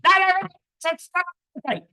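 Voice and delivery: fast and loud, blurted out; rushed. Shown as a demonstration. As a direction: rattle it off as a loud outburst. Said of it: "Let me say that slowly".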